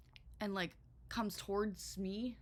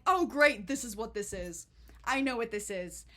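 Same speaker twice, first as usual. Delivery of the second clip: sudden knowing panic; loud sarcastic oh great; alarmed realization; 8 dB louder than usual. Retold or imitated. Realizing people are fleeing.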